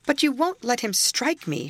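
high-pitched protest; pleading cry. A narrator's reading. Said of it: "she cried".

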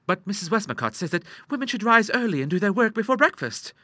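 put-on high-pitched girlish protest; earnest daughter. A narrator's reading. Ida quoting her book to her father, earnest. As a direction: argue earnestly in a high young voice.

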